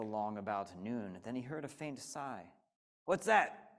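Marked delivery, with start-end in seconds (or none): 3.1-3.7 sharp sudden snap; snapped. Acted. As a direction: bite the question off. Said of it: "snapped the king".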